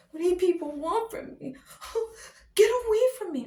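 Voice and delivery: high, crying-edged plea; mock tearful wail, loud at end; whiny hero. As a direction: beg them to leave you alone. Mocking the hero's whiny plea.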